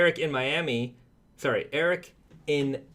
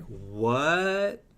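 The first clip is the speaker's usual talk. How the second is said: long, rising disbelieving "what"; mock astonishment. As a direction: draw it out as a stunned crowd's reaction. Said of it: "The wrestling world was like".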